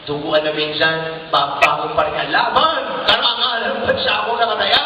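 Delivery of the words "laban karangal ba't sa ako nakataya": higher, fierce boxer's rallying yell; fighting cry. Retold or imitated. Pacquiao rallying himself after a knockdown, with a fierce cry. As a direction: roar like a boxer getting up.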